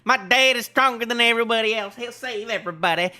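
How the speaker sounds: high, loud hammed-up kid voice; boasting child. Child bragging to everybody about Dad, loud hammed-up pride. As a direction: crow proudly with stretched words.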